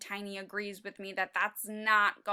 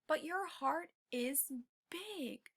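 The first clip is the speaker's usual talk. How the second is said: soft, slow reassurance; 3 semitones higher, 9 dB quieter, slower than usual. Acted. Reassuring the little character.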